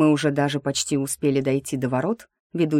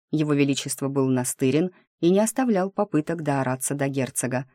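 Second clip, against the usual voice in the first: ordinary delivery, nothing marked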